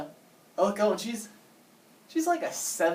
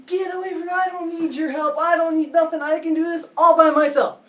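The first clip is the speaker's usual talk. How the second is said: high, loud angry yell at a stranger; retold yelling; 10 semitones higher, 8 dB louder than usual. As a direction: shriek it at him, furious and high. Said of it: "She yells at me".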